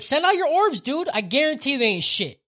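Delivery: high, loud taunting challenge; acted call-out. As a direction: call out your opponent with cocky confidence. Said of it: "he calls the leader out".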